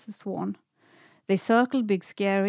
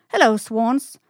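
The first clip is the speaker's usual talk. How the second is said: loud, bright friendly greeting, a bit higher; nice voice; 4 semitones higher, 8 dB louder than usual. A narrator's reading. Semlan flatters the swans to get a ride, loud sweet voice. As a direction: sweet-talk the swans loudly. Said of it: "said Semlan with a nice voice".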